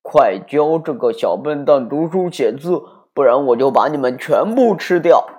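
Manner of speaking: loud menacing demand; threatening. A narrator's reading. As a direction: threaten the teacher. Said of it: "爸爸威胁老师说".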